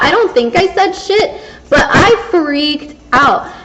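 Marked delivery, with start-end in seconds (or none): none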